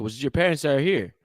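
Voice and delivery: usual speaking voice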